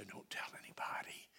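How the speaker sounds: hushed low whisper; a conspiratorial whisper; secretive whisper. Retold or imitated. Telling someone a secret.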